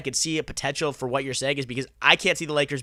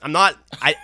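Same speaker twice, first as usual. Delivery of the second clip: laughing; 4 semitones higher, 8 dB louder than usual. Real, not acted. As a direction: break into real laughter mid-sentence. Caught out on Apple analogy.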